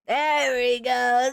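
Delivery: drawn-out satisfied there we go; slow drawn out. Real, not acted. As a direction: stretch it slowly. Found hotkey.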